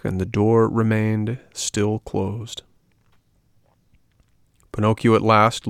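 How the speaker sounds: ordinary delivery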